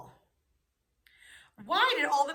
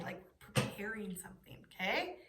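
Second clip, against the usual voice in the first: whisper; 4 semitones lower, 8 dB quieter than usual. Real, not acted. Teacher whispering gesture instruction.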